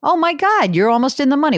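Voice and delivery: sudden amazed squeal; surprised. Real, not acted. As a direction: pitch jumps up in real surprise. Reacts to Peloton stock price.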